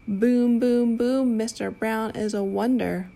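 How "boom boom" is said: loud booming thunder. Pretend. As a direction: boom it out like thunder. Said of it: "Mister Brown makes thunder".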